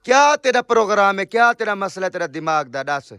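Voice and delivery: fed up with his mother's refusal, loud exasperated scolding. Real, not acted. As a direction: snap at her in exasperation.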